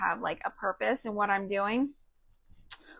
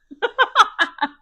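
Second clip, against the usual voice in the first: laugh; 10 semitones higher, 8 dB louder than usual. Real, not acted. Laughing at own family.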